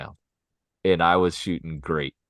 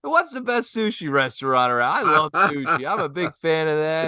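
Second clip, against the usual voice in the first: higher, eager friendly small talk; hamming it up; 8 semitones higher than usual. Retold or imitated. Golfer chatting up Japanese partners, overdone cheerfulness. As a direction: lay the friendliness on thick. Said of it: "I'm hamming it up with them now".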